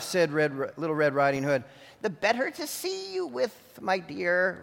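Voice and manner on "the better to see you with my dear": wolf as granny. A narrator's reading.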